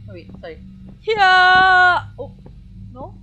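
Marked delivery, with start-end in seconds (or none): 1.0-2.0 long, loud battle cry; charging yell. Real, not acted. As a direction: draw out a yell as you charge. Psyching up to run.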